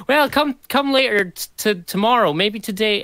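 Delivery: high, dithering indecisive voice; mock hesitant voice.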